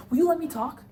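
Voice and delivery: loud, high snap of anger; angry yell. Acted. Father yelling at his wife to let him talk, furious. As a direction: bark it at her to shut her up. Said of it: "Don't yell at her, Pop".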